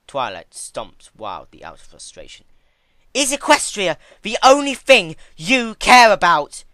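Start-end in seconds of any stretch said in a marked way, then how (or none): 3.1-6.6 shouted much higher and louder; furious shout. A narrator's reading. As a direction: hurl the accusation. Furious pupil confronting mentor.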